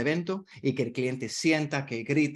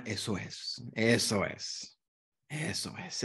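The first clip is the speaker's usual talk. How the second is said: soothing eso es; 4 semitones lower, 5 dB quieter, much slower than usual. Shown as a demonstration. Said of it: "utilicen la palabra".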